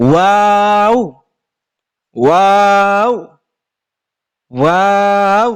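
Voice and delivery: ওয়াও stretched slowly with a rise; drawn-out Arabic waw. Shown as a demonstration. Teacher models the hard letter, long rising ওয়াও. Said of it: "এই হরফটা উচ্চারণ অনেকে করতে পারে না".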